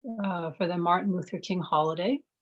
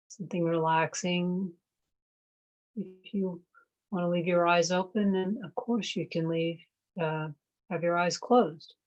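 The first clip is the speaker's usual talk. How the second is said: guided meditation. Real, not acted. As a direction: ease them into noticing the breath. Leads a mindfulness meditation.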